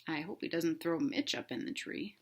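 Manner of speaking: plain reading voice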